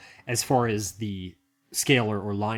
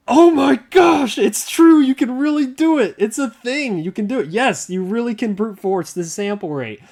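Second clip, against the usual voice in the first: high, loud, gushing mock fan outburst; over-the-top imitated amazement; imitating amazed viewer; 10 semitones higher, 9 dB louder than usual. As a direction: shriek it as an astounded fan, then ease slightly. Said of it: "a handful of you out there that are just like".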